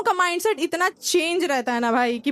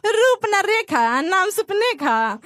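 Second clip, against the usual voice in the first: mocking mother; 4 semitones higher, much slower than usual. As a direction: drawl the rhyme like a teasing mother. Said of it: "मुझे क्या कह रही हैं?".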